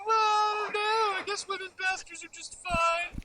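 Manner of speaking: high-pitched mocking voice; mock third-way believer. Co-host pretends he will turn third way, mocking high voice. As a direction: pitch it way up to parody a pushover. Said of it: "I'm gonna be all third way".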